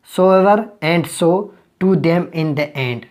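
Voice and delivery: very slow, word by word, for notes; slow dictation. Acted. Teacher dictating a grammar rule to students, very slowly. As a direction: read it out so students can write it down.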